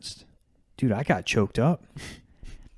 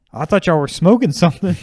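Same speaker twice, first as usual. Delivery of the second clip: imitating the dad; 8 semitones higher, 9 dB louder than usual. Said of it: "he's like".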